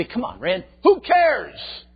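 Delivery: high, loud scoffing "who cares"; exaggerated dismissive outburst; mock dismissive objection. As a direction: brush it off loudly, pitch leaping up.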